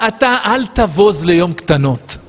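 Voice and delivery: ordinary talk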